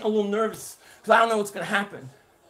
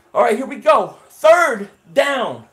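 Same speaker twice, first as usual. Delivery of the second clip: excited; 9 dB louder, slower than usual. Real, not acted. Calling a key third down.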